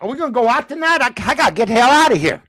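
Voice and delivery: fast imitated Southern accent; picked-up Southern accent. Draftee itching to go out, fast put-on Southern accent. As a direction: rattle it off in a mock Southern accent. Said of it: "everybody talks like that out there".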